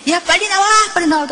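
mock impatient mom shouting; impatient call. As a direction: bang on the door and hurry the kid. Mother calling child out of bathroom.

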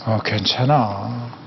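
low, very slow, soft comforting; whispering comfort to yourself inside; gentle reassurance. Shown as a demonstration. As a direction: stroke your own worry calm. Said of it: "이렇게 해줘야 돼".

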